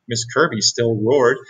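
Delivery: ordinary delivery, nothing marked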